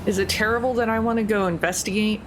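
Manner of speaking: ordinary talk, nothing marked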